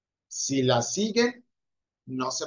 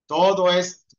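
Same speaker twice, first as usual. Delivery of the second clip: louder punched "Todo esto"; emphatic; 6 dB louder than usual. Shown as a demonstration. Teacher to students, stressing the phrase loudly. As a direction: stamp the words for the class. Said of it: "teacher drilling the rule".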